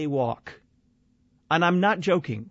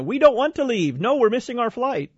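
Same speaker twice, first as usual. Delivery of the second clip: pitched up, mocking the stubborn crowd; whiny mock travellers; 8 semitones higher than usual. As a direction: whine it like a spoiled traveller who won't budge. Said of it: "You know".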